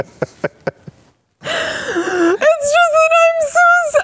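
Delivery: extremely high, squeaky tearful wail; hammed-up crying, choked off mid-word; mock sobbing. Host overcome by candidate's exit, mock sobbing. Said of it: "okay, I'm fine now".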